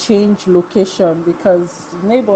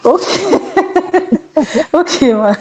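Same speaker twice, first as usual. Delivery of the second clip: amused laughter; 10 semitones higher than usual. Real, not acted. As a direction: laugh your way through the acknowledgement. Amused while greeting participants by name.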